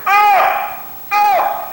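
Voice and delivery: two high-pitched yelps of oh; high, sudden mock wails; imitated cry of hurt. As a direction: mimic a child hollering in pain. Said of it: "Uh-oh, somebody's getting hurt".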